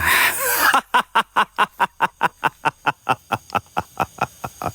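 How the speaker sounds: long cackling evil laugh; creepy evil laugh. Acted. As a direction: scare them with a creepy laugh. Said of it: "Kasih paham dengan ketawa ngerinya".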